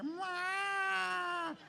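high, drawn-out meow; another coaxing meow. Shown as a demonstration. Pastor trying another way to lure the cat, high meow. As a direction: try a new meow to lure it. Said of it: "find every version to make that cat".

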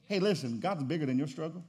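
usual speaking voice

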